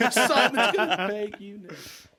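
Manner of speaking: high, loud, slow laughing line. Real, not acted. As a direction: laugh through the punchline. Laughing repeat of joke.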